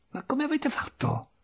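high, soft, incredulous question; hushed high-pitched bafflement; baffled disbelief. Acted. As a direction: marvel at how he managed it. Baffled at the trapped man.

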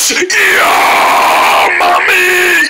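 wildly pitched, drawn-out scream; mock shock scream. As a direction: scream as if jolted, hammed up. Shock scene after "action.

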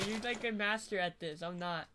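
normal talking voice

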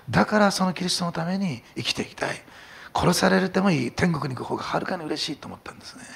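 力強い説教口調; impassioned preaching. Acted. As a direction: preach it with full conviction to the congregation. Climax of the sermon.

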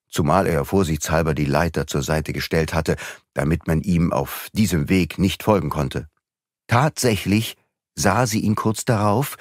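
usual speaking voice

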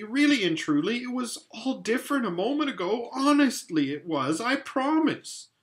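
high, earnest pleading; Lucy pleading with Peter she's honest. A narrator's reading. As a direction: beg them to believe you.

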